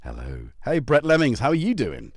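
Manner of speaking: usual speaking voice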